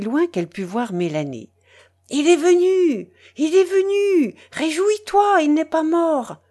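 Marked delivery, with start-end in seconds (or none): 2.1-6.4 high, excited cry of good news; jubilant, high-pitched announcement; joyful calling out. A narrator's reading. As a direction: call out the good news from afar. Mother calls joyful news from afar.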